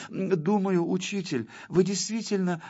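high-pitched, hesitant pupil voice; timid. A narrator's reading. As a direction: venture a tentative, placating opinion to teacher. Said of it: "несмело сказал". (несмело, робко)

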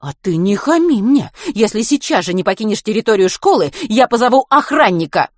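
put-on strict vice-principal catching boys outside; stern teacher. A narrator's reading. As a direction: interrogate like a strict head teacher. Vice-principal threatening Matvey.

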